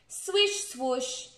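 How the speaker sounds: plain reading voice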